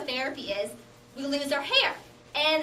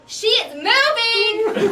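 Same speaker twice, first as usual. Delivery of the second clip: high, loud, slow exclaim; excited shout; 7 semitones higher, 9 dB louder, much slower than usual. Acted. Cancer buddies imagine the funeral, she shouts excitedly. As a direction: yell it like a miracle.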